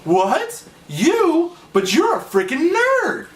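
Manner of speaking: high-pitched incredulous outburst, stretched out; imitating an astonished friend; mock disbelief. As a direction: act scandalized, pitch jumping up. Acted shocked reaction to his dislike.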